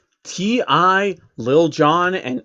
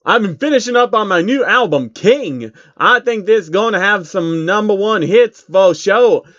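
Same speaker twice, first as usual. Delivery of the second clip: higher, louder mock rapper brag; lightly hammed rapper voice; 6 semitones higher, 5 dB louder than usual. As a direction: boast about your new album. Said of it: "[name] called back".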